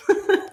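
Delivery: quick high laugh; a light amused laugh; a real chuckle at the end of the list; short laugh. Real, not acted. Laughs after listing factors.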